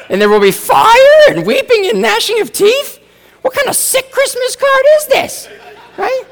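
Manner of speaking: very high, loud, hammed-up North Dakota accent; North Dakota relative voice. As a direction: squeal it like a folksy North Dakota relative reading a card. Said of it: "turned out to be from North Dakota".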